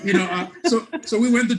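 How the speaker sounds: high, loud, laughing through the words; giggling as he restarts the story. Real, not acted. Laughing after air-quote joke.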